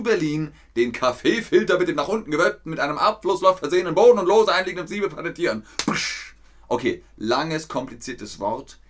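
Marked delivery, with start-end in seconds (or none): 0.8-5.6 rapid-fire reading. Shown as a demonstration. Teacher racing through a long patent title, rapid-fire reading. 5.9-6.3 one sharp voiceless "psch"; quick stamping sound effect; imitated stamp. Shown as a demonstration. Teacher stamping the patent, imitated stamp.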